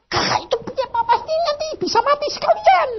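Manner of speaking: high, fast worried sibling voice; frantic younger sibling. Retold or imitated. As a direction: rattle it off high and anxious. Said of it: "Dia bilang".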